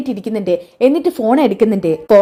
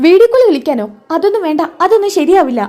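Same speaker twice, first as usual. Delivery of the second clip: high, louder shocked protest; jolted, push the idea away fast; startled refusal; 7 semitones higher, 4 dB louder than usual. Acted. Nandu, shocked when Anil asks to video call, high startled protest.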